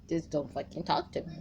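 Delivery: ordinary talk, nothing marked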